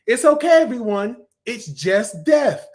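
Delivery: high, projected cartoon voice; quoted cartoon announcement. Fan quoting a favourite cartoon line, high and theatrical. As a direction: call it out high, drawing out the end.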